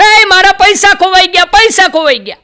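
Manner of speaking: loud, high cry of lost money; shout. Retold or imitated. Man at the show yelling that his money is lost. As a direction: yell it across the crowd. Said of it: "એક ભાઈ રાડ પાડી".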